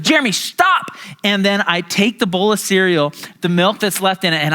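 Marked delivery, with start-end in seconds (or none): 0.0-1.0 higher, sharp warning; fed-up sister. Retold or imitated. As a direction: cut him off, fed up. Sister losing patience.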